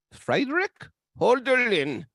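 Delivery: mock German accent, slow and loud; hammed-up German accent; poor German accent. Shown as a demonstration. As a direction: put on a bad German accent.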